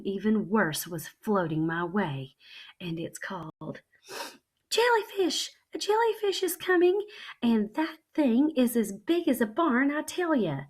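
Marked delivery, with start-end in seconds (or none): 4.7-10.6 very high and loud alarm; panicked yelling. Acted. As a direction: shout a warning in fright. Child panics at jellyfish.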